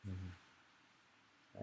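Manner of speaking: ordinary delivery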